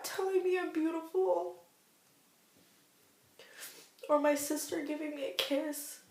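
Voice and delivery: real crying, voice pitched high; choked up with love and gratitude; tearful. Real, not acted. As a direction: push the words out through tears. Tearful talk of family support.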